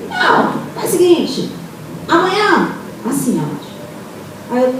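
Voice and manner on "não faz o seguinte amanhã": much higher, acted voice; acted phone arrangement. Speaker replays phone talk about cleaning, high-pitched proposal.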